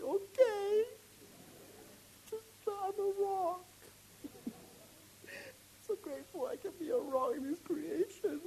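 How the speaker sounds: high, tearful, choked voice; real tears welling up as he speaks. Real, not acted. Preacher moved to tears over being a rock. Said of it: "I wanna cry for a long time".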